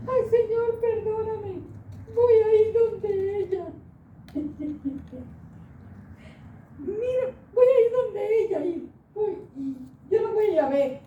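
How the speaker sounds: high, loud sobbing for forgiveness; crying plea. Retold or imitated. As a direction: cry out asking pardon. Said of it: "Empecé a dar grito". (clamor con llanto, agudo y fuerte)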